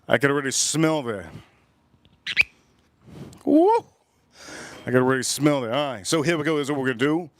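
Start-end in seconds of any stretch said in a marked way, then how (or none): 3.4-3.8 much higher and louder whoop of delight; real excited cheer. Real, not acted. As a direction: let out a big happy woo. Reacts to the whiskey smell.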